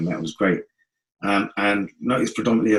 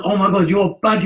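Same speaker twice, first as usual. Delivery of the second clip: loud, high retold exclamation; amazed praise; 12 semitones higher, 6 dB louder than usual. Friends praising the new MC, amazed outburst. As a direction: exclaim in excited admiration.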